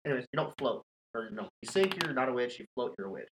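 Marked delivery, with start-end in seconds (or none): none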